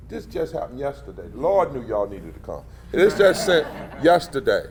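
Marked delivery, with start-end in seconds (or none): none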